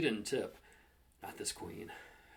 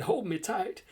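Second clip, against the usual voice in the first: high, louder, hammed-up plea; mock swooning; 9 semitones higher, 6 dB louder, slower than usual. A narrator's reading. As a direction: play along with the swoon. Narrator joins the mock swoon.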